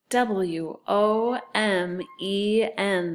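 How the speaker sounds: slow letter-by-letter chant; spelling aloud. Shown as a demonstration. Teacher leading sight word review, spelling aloud. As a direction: space the letters out evenly. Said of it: "and spell it".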